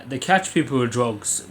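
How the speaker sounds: ordinary talk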